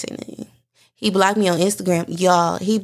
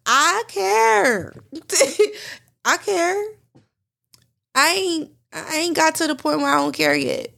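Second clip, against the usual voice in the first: crying, much higher, laughing through tears; tearful; 9 semitones higher than usual. Real, not acted. Admitting to listeners she still cares, tearful. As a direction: let the voice crack with hurt. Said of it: "I'm really crying right now".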